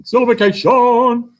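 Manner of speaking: high, loud, showy call of the word; a big playful proclamation; excited announcement. Real, not acted. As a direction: announce it like a game-show reveal. Teacher announcing the new topic.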